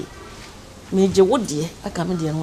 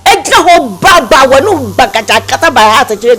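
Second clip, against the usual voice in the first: loud ridicule; 6 semitones higher, 5 dB louder than usual. Acted. Ridiculing the outfit loudly.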